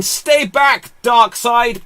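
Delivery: put-on high shouted battle cry with a faint Israeli accent; heroic shout. Wonder Woman facing Darkseid, shouted in a poor Israeli accent. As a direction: yell it at the villain. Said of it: "my best Israeli accent and it's not good".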